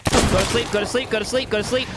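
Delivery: high, panicky, rushed pleading; frantic pleading. Real, not acted.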